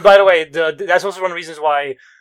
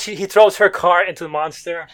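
ordinary talk

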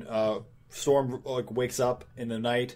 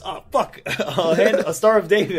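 raised, loud comic alarm; mock burning panic; 6 semitones higher, 8 dB louder than usual. Dracula burned by a Star of David, mock panic. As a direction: yelp it as if burned, half laughing. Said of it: "he starts burning. And he goes".

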